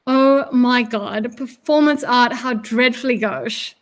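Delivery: slow, drawn-out disdain; theatrically unimpressed, dragging it out; scornful. A narrator's reading. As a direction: stretch the words in mocking contempt. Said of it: "laughs scornfully".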